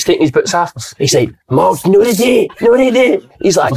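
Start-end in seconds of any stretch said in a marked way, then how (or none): 1.5-3.2 mock manager. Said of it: "gaffer voiced in story".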